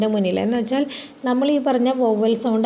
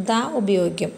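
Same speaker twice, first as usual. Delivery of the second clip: ordinary delivery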